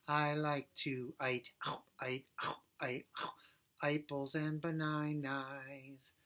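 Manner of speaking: "apples and bananas" sung as "aipples and banainais", slow; I vowel swap again. Shown as a demonstration. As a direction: keep the I sound on every vowel. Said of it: "We're gonna do I".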